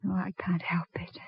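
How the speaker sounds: soft, breathy whisper of guilt; whispered grief. Acted. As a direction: barely voice it, near tears. Grieving widow at the tomb.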